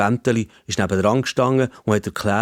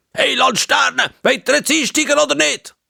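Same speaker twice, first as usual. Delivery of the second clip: much higher, louder tinny announcement; snapping over loudspeaker; 4 dB louder than usual. A narrator's reading. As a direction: bark at him through the tram speaker. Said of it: "über den Lautsprecher angeschnauzt".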